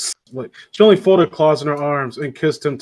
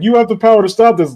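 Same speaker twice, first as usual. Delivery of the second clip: higher, louder, quick mock child challenge; pleading kid hero; 6 semitones higher, 6 dB louder, faster than usual. Little kid confronts evil Santa Claus, mock challenge. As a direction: blurt it like a plucky little kid.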